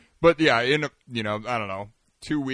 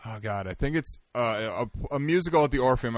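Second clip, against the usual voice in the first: normal talking voice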